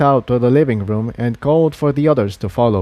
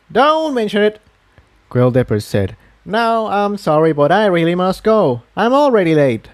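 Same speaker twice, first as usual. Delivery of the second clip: a busy elder pony, higher and unhurried at first; kindly but harried townspony handing out gear; lightly voiced Quill Dipper; 7 semitones higher than usual. A narrator's reading.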